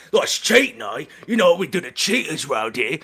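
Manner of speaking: high-pitched menacing accusation; lightly voiced cockney threat; threatening card player. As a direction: threaten the cheat. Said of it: "He'll stand up".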